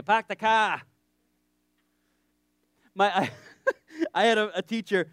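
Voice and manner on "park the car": put-on Boston accent. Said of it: "or Boston".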